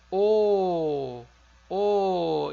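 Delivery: loud O, then repeated; quiz answer. Shown as a demonstration. Teacher giving the quiz answer, loud. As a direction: call the vowel out firmly. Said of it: "vai ser a vogal".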